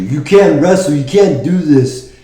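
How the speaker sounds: high jeering mock voice; mocking cynics. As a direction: sneer like the doubters. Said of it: "cynics who told you".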